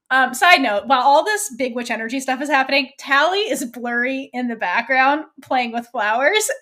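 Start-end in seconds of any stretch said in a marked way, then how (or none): none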